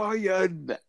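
high, over-clear word; talking to a foreigner. Retold or imitated. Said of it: "you just go".

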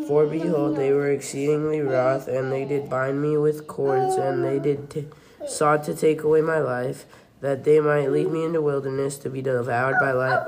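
ordinary talk

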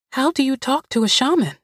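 put-on high, fast child's question; eager little girl. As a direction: ask quickly like an eager child. Mildred asks eagerly.

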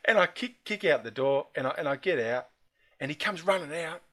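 ordinary talk, nothing marked

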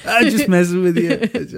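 high, slow put-on cartoon voice; cartoon character quote. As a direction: quote the cartoon line in a silly voice. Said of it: "Where Brian's trying to".

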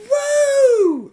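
very high, loud Woo! whoop; high shrieking crowd whoop; mock whoop. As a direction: whoop like the rowdy women. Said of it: "they kept going".

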